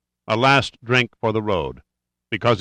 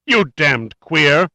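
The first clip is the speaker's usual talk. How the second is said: slow, high, loud furious outburst; shaking with fury; 6 semitones higher, 5 dB louder, much slower than usual. A narrator's reading. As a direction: spit it out slowly in rage. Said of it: "his voice shaking with fury".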